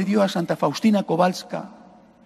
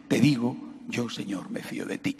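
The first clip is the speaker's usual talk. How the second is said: softer, sinking to a whisper; whispered prayer; 6 semitones lower, 6 dB quieter than usual. Acted. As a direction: breathe the trust like a confession. Priest voices a whispered prayer.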